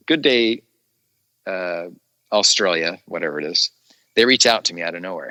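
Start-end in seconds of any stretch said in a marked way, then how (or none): 0.1-0.6 slow Aussie "Good Day" with a falling end; Australian accent. Shown as a demonstration. Actor showing off his Australian greeting. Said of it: "I like talking Australian".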